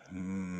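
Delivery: long, steady droning hum; soothing hum. Shown as a demonstration. Showing off their soothing voice to a listener, long soothing hum. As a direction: hold one even hum for seconds. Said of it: "I could just sit here and just go".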